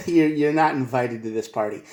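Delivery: ordinary talk, nothing marked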